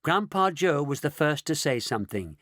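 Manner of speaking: ordinary delivery, nothing marked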